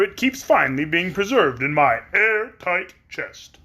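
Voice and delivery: metallic grateful tin man; polite Tin Woodman. Acted. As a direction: speak as a kindly tin man.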